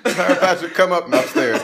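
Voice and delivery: wheezing laugh into the words. Real, not acted. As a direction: laugh while telling it. Laughing through retelling.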